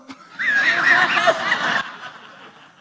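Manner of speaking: high wheezing laughter; airy laughing fit; real laugh. Real, not acted. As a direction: laugh at the irony. Laughing retelling the call.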